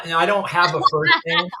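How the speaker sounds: usual speaking voice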